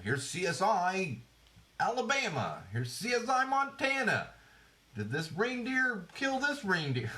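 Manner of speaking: high, slow hammed-up promo voice; mock TV promo. Host parodying yet another C S I spin-off, mock promo. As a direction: put on a high mock-dramatic show announcer.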